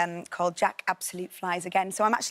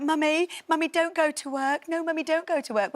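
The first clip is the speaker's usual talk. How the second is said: imitating a small boy, higher; little son pleading; 9 semitones higher than usual. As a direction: plead like a toddler begging mum to stay. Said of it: "every night he was like".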